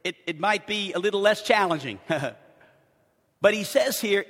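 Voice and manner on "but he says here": ordinary delivery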